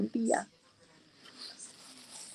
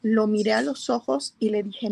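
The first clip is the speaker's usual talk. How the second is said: usual speaking voice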